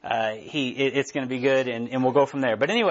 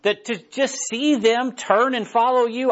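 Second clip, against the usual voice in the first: bitter Jonah; 6 semitones higher, slower than usual. Acted. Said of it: "He's like".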